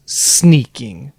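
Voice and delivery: put-on sulky teenager reply, loud drawn-out word; mock-sullen teenage comeback; Gollum. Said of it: "absolutely like a teenager".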